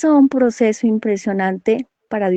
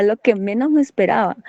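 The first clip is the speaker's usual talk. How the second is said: normal talking voice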